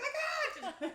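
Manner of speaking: high, loud laughing cry; laughing squeal. Retold or imitated. Her own retold reply, laughing.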